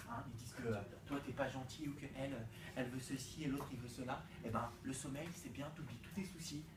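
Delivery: gentle sleepy voice; soft and dreamy. Acted. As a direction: ease them toward sleep. Praising sleep, soothing contrast.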